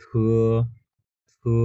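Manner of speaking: breathy th, then pull the উ; থু said as thu; thu with u glide. Shown as a demonstration. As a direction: say থু as aspirated th plus a drawn u. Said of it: "এখানেও আমরা উ টানটা দিব".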